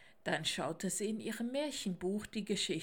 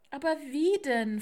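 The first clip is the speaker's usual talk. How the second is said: louder, higher, helpless question; desperate; 6 semitones higher, 8 dB louder than usual. Acted. Mama Owl asks the raven how, desperate. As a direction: plead in despair. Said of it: "fragte Mama Eule verzweifelt".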